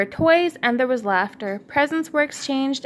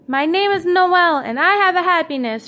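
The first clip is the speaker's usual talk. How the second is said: bright, louder and higher joyful cry; excited shout; 7 semitones higher, 6 dB louder than usual. A narrator's reading. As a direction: call out proudly with joy. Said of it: "shouted the magical little ornament".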